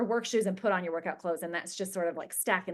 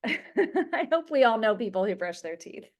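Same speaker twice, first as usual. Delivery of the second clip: laughing through the words, louder; amused laughing; 4 dB louder than usual. Real, not acted. Coach joking with her group about toothbrushing, laughing. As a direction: let a real laugh spill into the sentence.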